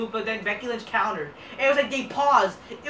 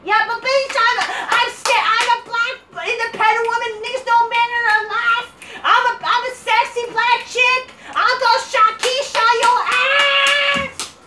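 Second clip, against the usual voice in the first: much higher, louder caricature voice demanding her match; mock Naomi rant; 12 semitones higher, 5 dB louder than usual. Ranting wrestler demanding her missing match, high hammed-up shriek. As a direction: ham up a shrieking diva whining about her match. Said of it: "Naomi's like".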